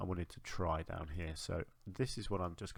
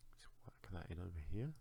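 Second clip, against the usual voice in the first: whispering; 9 dB quieter than usual. Real, not acted. Quiet aside while placing items.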